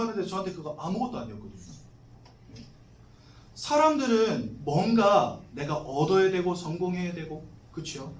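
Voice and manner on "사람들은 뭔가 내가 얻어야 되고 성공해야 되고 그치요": ordinary talk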